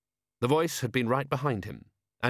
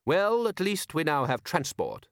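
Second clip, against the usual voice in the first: higher, mildly decisive patrician; show is over; 7 semitones higher than usual. A narrator's reading. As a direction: close the squabble and move on. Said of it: "his tone suggesting that the show was over".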